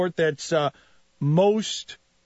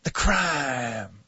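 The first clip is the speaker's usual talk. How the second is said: Ray Lewis whisper shout; much slower than usual. Retold or imitated. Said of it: "I talk in that whisper shout of the preacher man".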